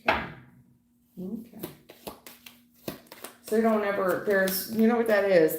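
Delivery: ordinary talk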